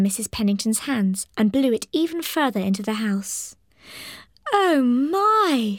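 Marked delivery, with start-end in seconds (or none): 4.5-5.8 startled, high fluttery lady's cry; flustered old lady. Acted. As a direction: gasp it out like a fussy old woman. Mrs Pennington flustered by wind.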